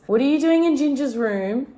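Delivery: high, loud playful scolding; teasing dog. Real, not acted. Owner catches dog sniffing in Ginger's room, playful high scold. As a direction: catch the nosy pup in the act.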